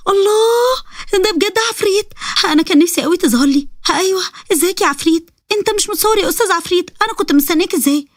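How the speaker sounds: put-on high, eager girl voice; delighted girl. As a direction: gush at the ifrit like a longed-for friend.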